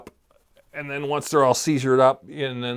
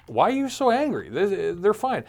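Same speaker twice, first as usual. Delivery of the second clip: incredulous; 7 semitones higher than usual. Retold or imitated. Retold baffled neighbors.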